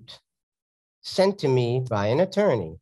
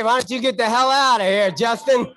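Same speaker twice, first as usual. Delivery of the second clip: mock annoyed; 8 semitones higher, 4 dB louder than usual. Joking at the interrupting Justin.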